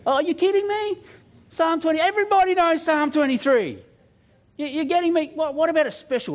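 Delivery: high, exasperated disbelief; incredulous protest. Retold or imitated. Preacher protesting God's choice of psalm, high exasperated whine. Arguing with God about Psalm 23.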